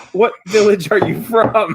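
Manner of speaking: lightly voiced curious wife, amused; villager wife's question. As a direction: ask brightly, a laugh close behind. Wife's question, breaking into laughter.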